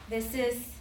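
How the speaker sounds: ordinary delivery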